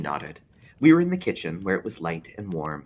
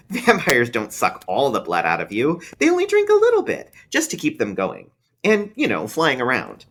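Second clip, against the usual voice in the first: put-on higher, louder know-it-all child; a kid correcting a grown-up; young boy, scoffing; scornful small boy; 6 semitones higher, 7 dB louder than usual. A narrator's reading.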